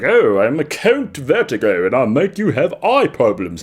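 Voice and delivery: put-on hammy foreign-count accent, swooping pitch; Count Vertigo. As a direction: put on a silly villain accent. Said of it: "And he talks like this".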